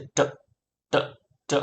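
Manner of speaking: ટ as a brief stop with hardly any vowel; short sound. Shown as a demonstration. Teacher drills the letter's sound, short separate ટ. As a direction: cut each ટ off at once. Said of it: "એનો અવાજ ટૂંકો છે".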